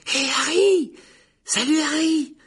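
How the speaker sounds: very high, loud, eager greeting; adoring young fan. A narrator's reading. Second-year admirer hails Harry, excitedly. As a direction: call out in a high boyish voice. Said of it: "une véritable véneration pour Harry".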